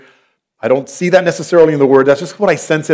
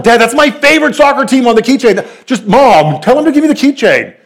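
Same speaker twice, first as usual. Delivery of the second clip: put-on much higher and louder, a teen begging for the keychain; pleading son; 9 semitones higher, 3 dB louder than usual. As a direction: whine up to Mom for backup. Son begging dad and mom.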